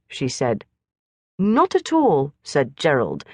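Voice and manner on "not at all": very polite. A narrator's reading. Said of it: "more polite than ever".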